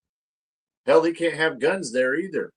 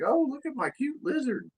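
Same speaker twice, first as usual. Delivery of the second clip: put-on much higher, gushing baby-talk voice; mock cutesy reptile fan; 13 semitones higher, 4 dB quieter than usual. Mocking his reptile-loving friends, in a high cooing voice. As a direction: coo it high like a doting lizard owner. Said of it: "and they're like".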